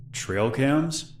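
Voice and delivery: "Trail cams?" asked high, rising sharply on "cams"; startled echo. Acted. Stranger thrown by the girl's mention of "Trail cams?", rising and surprised. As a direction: echo it back, caught off guard. Stranger echoes her, caught off guard.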